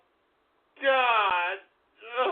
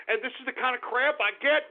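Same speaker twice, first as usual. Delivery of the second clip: usual speaking voice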